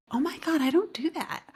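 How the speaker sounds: shocked realization. Retold or imitated. Sudden realization retold.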